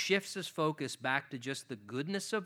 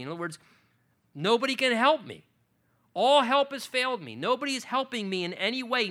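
raised, louder preaching voice pleading David's lament; passionate preaching; 5 semitones higher, 5 dB louder than usual. Acted. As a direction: preach it hard and loud, full of anguish.

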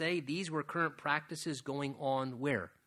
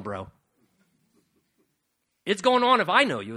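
loud, fast, driving preacher's push; preaching at full force, loud and quick; forceful preaching; 3 semitones higher, 8 dB louder, faster than usual. Acted. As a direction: hammer it home to the congregation. Preacher pressing the point, rising.

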